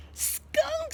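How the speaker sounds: high squeal of the name. Acted. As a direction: squeal it with delight. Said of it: "squeals Mole".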